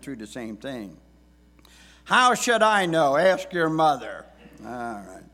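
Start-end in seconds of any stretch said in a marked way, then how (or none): 2.0-4.3 loud, high brush-off; exasperated dad. Retold or imitated. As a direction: snap it back like a busy father. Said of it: "words that many dads have said".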